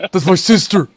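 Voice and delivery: hammed-up shocked yell; imitating the clip. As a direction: shout it like the meme. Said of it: "He says it like that".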